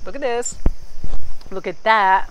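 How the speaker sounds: excited showing off. Real, not acted. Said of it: "I'm gonna show you".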